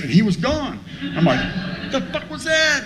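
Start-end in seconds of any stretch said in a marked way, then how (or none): 1.4-2.8 high, drawn-out squeal of disbelief; baffled outburst. Retold or imitated. Comic reacting after the stranger suddenly left, baffled outburst. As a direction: stretch it out high, utterly bewildered.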